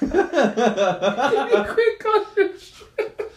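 drawn out through helpless laughter; echoing the punchline while cracking up; laughing repeat. Retold or imitated. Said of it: "repeating the quote".

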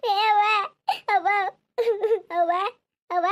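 loud, sobbing calls; wailing for mother. Acted. Daughter calling to her dead mother, wailing. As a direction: cry out for her between sobs.

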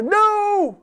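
high pleading cry of no; a high begging cry; desperate no. Acted. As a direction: plead like a dying man. Hezekiah begs God not to die.

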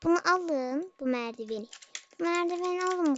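usual speaking voice

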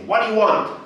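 loud, high, abrupt demand; acted suspicious resident; challenging through the door. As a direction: confront an unwelcome caller.

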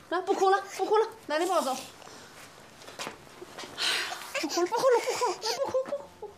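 put-on hushing the crying child, rising soft; soothing. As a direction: coax the baby to stop crying. (轻声哄孩子)

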